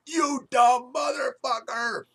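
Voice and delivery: high, loud, slow screamed insult; furious yelling. Retold or imitated. Mom screaming at the goalie again: high, loud furious yell. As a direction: howl it at the goalie. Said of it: "she's yelling".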